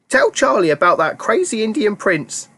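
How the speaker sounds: put-on a proud old grandma chiming in. A narrator's reading. Grandma Josephine boasting to Charlie, high old voice.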